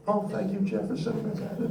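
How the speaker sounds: high, soft, tearful thanks; choked-up thanks. Retold or imitated. Astronaut thanking Jeff after the flight, softly and tearfully. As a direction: thank Jeff through tears, up high and hushed. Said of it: "I don't know what I'm crying about".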